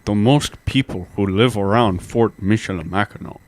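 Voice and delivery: half-hearted chief voice. Shown as a demonstration. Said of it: "Yes, you can".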